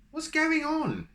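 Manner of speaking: very high, loud shriek; mother shrieks up from below, very high. Acted. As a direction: shriek up in alarm. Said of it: "shrieked his mother".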